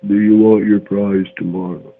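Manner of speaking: ordinary delivery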